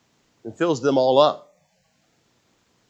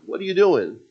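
higher-pitched challenge; mocking onlooker; 9 semitones higher, 3 dB louder than usual. Preacher imitates neighbors mocking the widow, mock challenge. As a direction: call out a mocking question.

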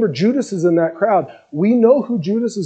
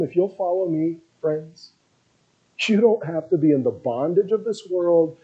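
much softer, gentle plea; tender invitation; 4 semitones lower, 7 dB quieter than usual. Acted. Jesus inviting his friends to follow him, softly tender.

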